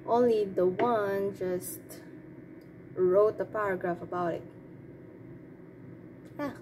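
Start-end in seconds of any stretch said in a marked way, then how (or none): none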